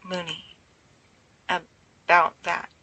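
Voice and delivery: slow, hesitant and unsure; halting, unsure, very slow; uncertain. A narrator's reading. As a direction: feel your way through it slowly. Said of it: "he says uncertainly".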